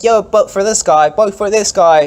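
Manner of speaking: high, loud street hustler pitch; mock canvasser yelling at passersby; acted campaigner. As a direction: call out like a guy pushing a candidate. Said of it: "and it's like".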